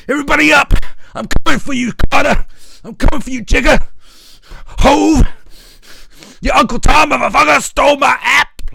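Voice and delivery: high, loud yelling rant; mock tirade. Imitating [name] yelling at [name] on air, high loud rant. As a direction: bellow threats like a radio host gone mad. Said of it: "dropping bombs and saying".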